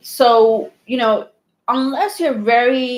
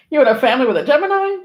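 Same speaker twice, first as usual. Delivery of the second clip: mock disbelief; 6 semitones higher, much faster than usual. Real, not acted. Teasing disbelief at Geminis.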